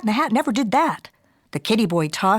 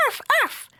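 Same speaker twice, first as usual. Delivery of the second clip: high squeaky mock bark. A narrator's reading. Sister teasing Skippy with puppy barks, high and squeaky. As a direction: yip it like a tiny dog, very high. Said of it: "barked Jujubee".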